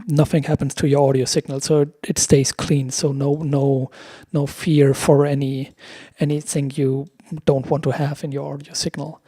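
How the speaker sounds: ordinary talk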